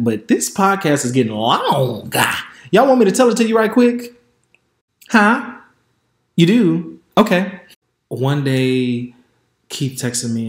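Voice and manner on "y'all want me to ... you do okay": excited. Real, not acted. Teasing the audience, asks them.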